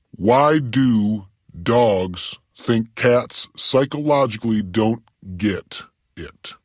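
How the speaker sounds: word by word, spaced out; slow, deliberate. Acted. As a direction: improvise it slowly, one word at a time. Spells out made-up acronym meaning.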